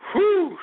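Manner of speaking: high loud exhale of relief; loud whew. Real, not acted. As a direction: blow out hard after the beer. After drinking.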